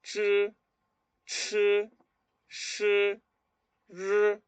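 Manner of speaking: "ch", "sh", "r" as retroflex drill sounds; isolated "ch", "sh", "r" with gaps for repetition; tongue pulled back. Shown as a demonstration. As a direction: curl the tongue back and hold each "ch", "sh", "r". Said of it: "Lengua para atrás".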